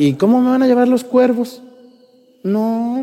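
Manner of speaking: high, incredulous mock objection; high skeptical question into a long no; doubting protest. Same doubter's objection heard again.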